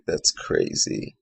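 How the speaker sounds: slow, breathy whisper of disbelief. Retold or imitated. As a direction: hush it out, slowly, stunned. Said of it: "I'm just like".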